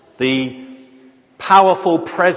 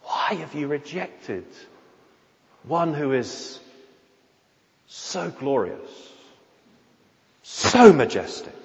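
anguish. Retold or imitated. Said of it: "Isaiah felt that sort of anguish".

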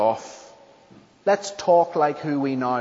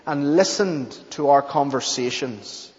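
ordinary delivery

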